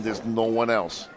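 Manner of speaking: normal talking voice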